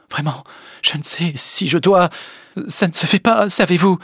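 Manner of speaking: high, hesitant protest; flustered reluctance. A narrator's reading. Emma to Léon before the cab, flustered and reluctant. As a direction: protest weakly, pitch climbing high.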